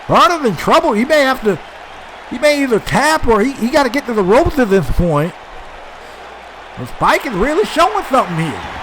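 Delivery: fast, high, hyped ringside call; excited wrestling commentator. Acted. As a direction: call the big moves like live TV. Excited live play-by-play.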